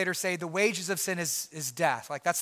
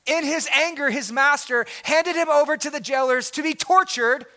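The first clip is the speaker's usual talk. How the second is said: louder, higher, rebuke hammered home; stern scripture reading; 6 semitones higher, 6 dB louder than usual. Acted. Pastor reading the master's rebuke to the congregation, loud and stern.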